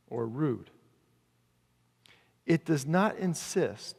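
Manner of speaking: very slow, deliberate scripture reading; measured verse, dropping low at phrase ends. Real, not acted. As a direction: read phrase by phrase with long pauses. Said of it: "I want to read it slowly to you".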